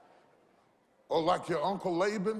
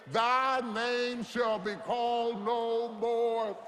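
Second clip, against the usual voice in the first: louder, slow and deliberate; slow emphatic proclamation; 5 semitones higher, 4 dB louder, much slower than usual. Shown as a demonstration. Preacher has church declare new name slowly. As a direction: lay each word down slowly. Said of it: "say it that time".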